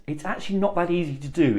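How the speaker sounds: normal talking voice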